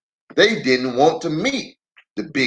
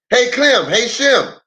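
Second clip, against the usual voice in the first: high, louder call to brothers; 9 semitones higher, 4 dB louder than usual. Acted. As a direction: holler across the car. Lem calls to his brothers.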